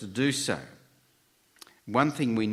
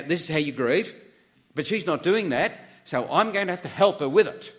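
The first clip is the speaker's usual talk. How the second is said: higher, louder, imitating a meddling helper; insistent neighbour; 7 semitones higher, 5 dB louder than usual. Neighbour deciding how the widow must grieve, voiced insistently. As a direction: push each phrase up like an imposing busybody. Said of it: "The neighbour had in her mind".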